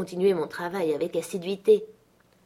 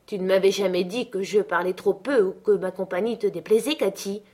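ordinary delivery, nothing marked

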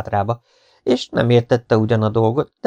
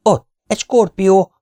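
high, loud frightened cry; terrified; 12 semitones higher, 9 dB louder than usual. A narrator's reading. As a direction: raise the alarm in a panicky high voice. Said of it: "mondta rémülten a partizán".

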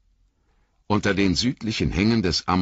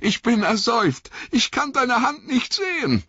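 high, panicked plea from the water; panicked gasping; 3 dB louder than usual. Acted. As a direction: gasp it out like you're drowning. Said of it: "keuchte Sam".

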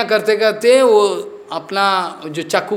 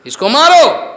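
high, loud burst of urging; forceful command; 8 semitones higher, 5 dB louder than usual. Real, not acted. Preacher urging disciples against their inner enemy, forceful shout. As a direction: fire it out as an urgent order.

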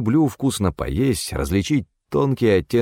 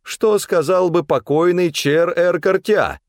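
very high, slow, scandalized scolding; drawn-out, high-pitched reproach; indignant cook; 11 semitones higher, 4 dB louder, slower than usual. A narrator's reading. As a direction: scold him like an outraged old family cook. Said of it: "возмутилась кухарка".

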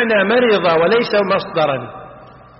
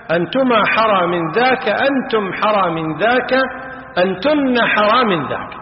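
ordinary talk

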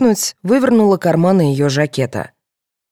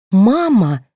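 drawn, moaned cry, less high-pitched; groaning; 6 semitones higher, 5 dB louder than usual.

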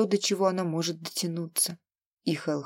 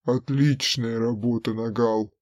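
low, cold approval; deep villain praising coldly; 8 semitones lower than usual. Acted. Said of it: "одобрил Хараун". (низкий холодный голос злодея)

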